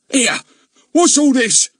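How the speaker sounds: put-on high, loud startled old man; knocked off balance and cross; old gardener nearly knocked over by a boy; startled and annoyed. A narrator's reading. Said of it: "startled and annoyed".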